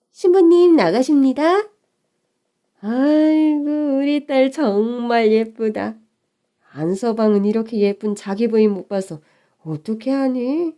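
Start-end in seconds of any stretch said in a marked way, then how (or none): 0.1-1.7 raised announcing call, higher. Acted. Staff announcing the bride coming out, raised voice. 2.8-6.0 slow, delighted motherly sigh of praise; drawn-out gushing over her daughter; admiring mother. Acted. Mother admiring her daughter in the dress, slow and fond.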